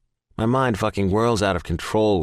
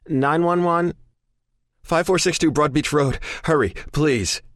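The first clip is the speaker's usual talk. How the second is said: panicked; 9 semitones higher than usual. Acted. Panicked call to emergency operator.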